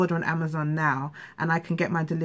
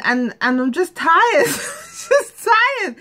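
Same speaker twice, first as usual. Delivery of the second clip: crying; 10 semitones higher, 4 dB louder, much slower than usual. Real, not acted. Vlogger breaking down over money worries, crying. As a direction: voice breaking into real tears, pitch jumping up. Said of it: "I'm gonna try not to cry".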